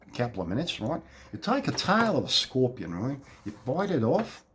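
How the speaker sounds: broad Australian with rising ends on phrases; Australian accent. Shown as a demonstration. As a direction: tell it like an Aussie, each phrase lifting like a question. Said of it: "Which is really Australian, right?".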